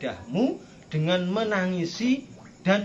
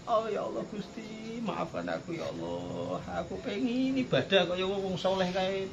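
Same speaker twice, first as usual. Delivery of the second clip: soft mock-tearful prayer; whining plea; 6 dB quieter than usual. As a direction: whine to God, stretching the words. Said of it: "merengek-rengek sama".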